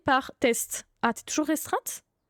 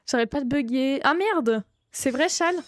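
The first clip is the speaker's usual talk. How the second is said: ordinary delivery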